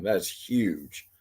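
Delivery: ordinary talk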